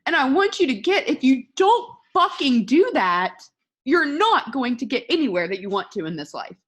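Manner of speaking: forceful tough love, key words punched; heated warning. Real, not acted. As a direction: drive the warning home hard.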